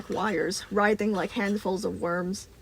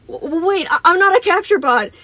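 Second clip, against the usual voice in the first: loud, high, stuttering protest; panicked stammer; 10 semitones higher, 15 dB louder than usual. A narrator's reading. Boy snaps out of silence, stammering in loud panic. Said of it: "snapping out of the stunned silence".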